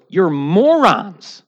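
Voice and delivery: drawn-out scornful verdict from God; lightly voiced God pronouncing judgment; God's verdict. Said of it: "And God goes".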